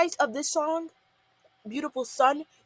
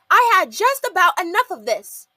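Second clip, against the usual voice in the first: loud, higher fed-up outburst; stomping fury; 7 semitones higher, 9 dB louder than usual. Retold or imitated. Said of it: "she says stompingly".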